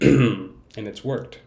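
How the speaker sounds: slow triumphant announcement; loud emphatic. Shown as a demonstration. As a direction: say it out loud with full weight.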